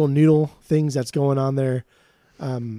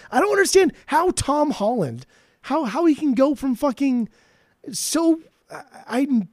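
baffled rant; 8 semitones higher than usual. Real, not acted. Baffled rant about Tom Holland.

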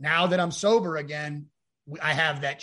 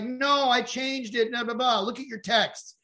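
higher, slow, exasperated correction; exasperated ex-wife; 7 semitones higher, 3 dB louder than usual. Retold or imitated. Ex-wife insisting she sent the new address, slow and fed up. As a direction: spell it out to him slowly.

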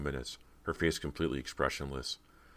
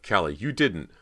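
loud, higher, disbelieving cry; horrified cry; 4 semitones higher, 7 dB louder than usual. A narrator's reading. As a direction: protest in horror. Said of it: "Cody cried in horror".

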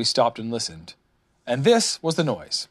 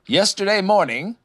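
raised, mildly solemn announcement; 8 semitones higher, 4 dB louder than usual. A narrator's reading. Rabbit reports to Owl about the notice, solemn. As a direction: begin a grave report. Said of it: "said Rabbit solemnly".

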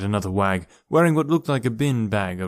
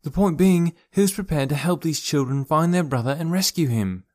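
higher-pitched, insistent question, BEING kept short; frustrated; 6 semitones higher than usual. A narrator's reading. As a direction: push on to the question with mild exasperation. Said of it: "Laurel sighed with frustration".

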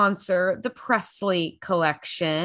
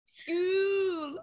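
high, softer mimicked wail on "School"; child's accent; 10 semitones higher, 6 dB quieter than usual. Echoing the little girl's favourite word, high accented child voice. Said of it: "When she says school".

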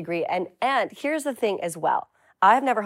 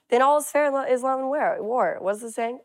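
foggy hungover muddle; 4 semitones higher, faster than usual. Real, not acted. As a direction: fumble words, hungover and groggy. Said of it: "I'm hungover".

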